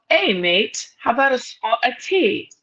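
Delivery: put-on light, hammy American take on a British accent; mock British accent. Host testing the guest with a put-on British accent. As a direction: toss off a jokey fake British accent. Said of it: "trying to do a British accent".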